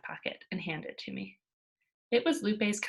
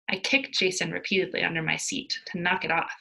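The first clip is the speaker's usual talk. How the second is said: ordinary delivery, nothing marked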